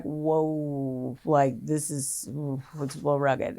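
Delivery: quiet, slow, low mock-cop reaction; low awed mutter. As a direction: hush it like a stunned cop. Cops react to filthy house.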